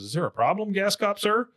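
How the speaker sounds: skit driver. Retold or imitated. Bobby as driver questions the gas cop, mock-innocent. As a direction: ask with a rising, mock-innocent lilt. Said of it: "who's pretending to drive a car".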